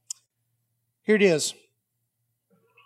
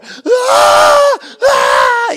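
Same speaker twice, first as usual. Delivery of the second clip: very high, very loud held wail; hammed-up full-volume bawl; child's bawling wail; 14 dB louder than usual. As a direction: imitate a kid wailing on the floor. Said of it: "He's sitting on the floor".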